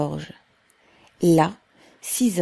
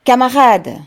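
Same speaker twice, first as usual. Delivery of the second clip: higher, much louder rallying call; cry out; 6 semitones higher, 10 dB louder than usual. A narrator's reading. Pavel addressing the factory crowd, crying out. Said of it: "On entendit son cri".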